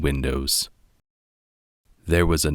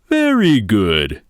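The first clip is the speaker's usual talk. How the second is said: louder, hearty father bear; Papa voice; 3 semitones higher, 6 dB louder than usual. A narrator's reading. Father bear boasting to his cubs, hearty and loud. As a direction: boom it out like a proud papa bear.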